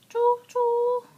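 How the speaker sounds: loud high choo-choo call; train whistle imitation. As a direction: belt it out like a train horn.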